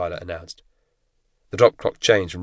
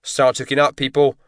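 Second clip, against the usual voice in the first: higher, louder call across the aircraft; shouted order; 6 semitones higher, 5 dB louder than usual. A narrator's reading. Jump master calls trainees to hook up, shouted. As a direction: bark it over the engine noise. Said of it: "Mister Pike shouted".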